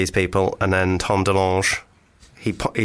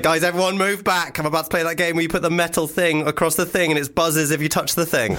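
raised, excitable pitch throughout; mock crowd announcement; 7 semitones higher than usual. Fan explaining a board game to moshers, mock excited. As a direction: gather everyone in a high put-on voice.

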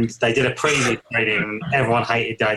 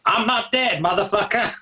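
high-pitched imitation of a film shout; hammed-up movie-hero yell; mock shout; 8 semitones higher than usual. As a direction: shout it in mimicry, pitched way up without extra volume. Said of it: "and he shouts".

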